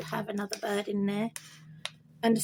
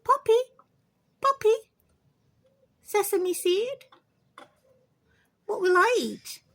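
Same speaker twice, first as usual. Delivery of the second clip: very high, loud parrot-teaching voice; coaxing pet bird; 10 semitones higher, 5 dB louder than usual. Pretend. Owner teaching bird food names, high-pitched chirping.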